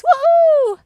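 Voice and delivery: high, loud woohoo; victory cheer. Acted. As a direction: whoop like you just won.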